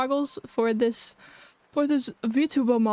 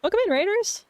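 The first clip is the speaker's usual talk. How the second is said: very high, bright excited greeting; cute anime streamer; 11 semitones higher than usual. Real, not acted. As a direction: sing out the welcome to new viewers. Greeting incoming raiders.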